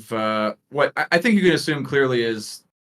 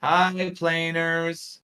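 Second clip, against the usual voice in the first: high, very drawn-out schoolchildren's greeting; class in chorus; 7 semitones higher, much slower than usual. Acted. Kids all greeting visitors on cue, slow chorus. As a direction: drone it slowly like a class in unison. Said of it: "as they all go".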